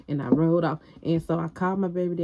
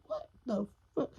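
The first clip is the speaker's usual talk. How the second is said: quiet, high, breathy disbelief; stunned hushed disbelief; 6 semitones higher, 10 dB quieter than usual. Retold or imitated. She reacts to boyfriend's order, stunned and quiet. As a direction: mouth it in shock, softly.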